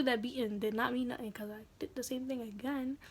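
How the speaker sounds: hushed confessional aside; whisper. Real, not acted. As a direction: whisper it to the viewers. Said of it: "whisper heard".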